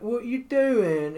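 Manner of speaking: high, very slow mock British; drawn-out Love Island parody; hammed-up British accent. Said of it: "and I'm like".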